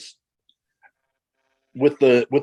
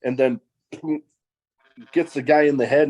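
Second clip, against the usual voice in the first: normal talking voice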